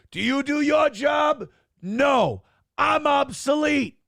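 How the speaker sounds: high, shouted, slow mock rant. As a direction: yell it like an outraged toilet. Toilet ranting as imagined character.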